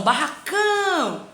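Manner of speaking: "barracão" with a long drawn-out "cão"; stretched tonic syllable. Shown as a demonstration. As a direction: hold the final "cão" of "barracão". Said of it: "sílaba que você passa mais tempo". (chamando "barracão", com o "cão" alongado)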